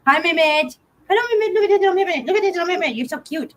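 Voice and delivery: high, doting cat voice; pet talk. Real, not acted. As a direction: gush over the kitty in a high voice.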